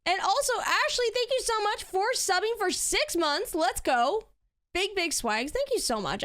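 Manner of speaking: bright high-pitched gushing thanks; excited high thanks. Real, not acted. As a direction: squeal out the thank-you. Thanking a six-month sub.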